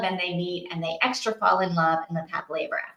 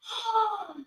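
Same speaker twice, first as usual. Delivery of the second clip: gasp; 12 semitones higher, 6 dB quieter than usual. Real, not acted. Reacting to plot twist.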